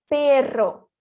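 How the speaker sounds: "perro" with a long trilled RR; slow teacher demo of "perro"; rolled R. Shown as a demonstration. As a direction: roll the RR in "perro" hard. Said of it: "vibrar muito mais a minha língua".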